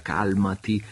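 insisting he calm down; firm calming. Acted. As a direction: press the word calmati home. Yanez repeats, more firmly.

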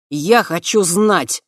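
loud, slow, stubborn insistence; stubborn demand. A narrator's reading. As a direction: dig in and demand it loudly. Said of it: "упрямо произнёс князь". (упрямо и громко)

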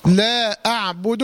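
لا أعبد recited slowly with every letter kept light; light thin letters. Shown as a demonstration. Tajweed teacher showing the light letters of لا أعبد. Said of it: "هذه الكلمة كلها كل حروفها مرققة".

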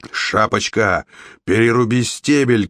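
understated shouted order; mild narrator's version of a shout; urgent shout. A narrator's reading. Jack tells Red to chop the stalk, shouting. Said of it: "крикнул Джек".

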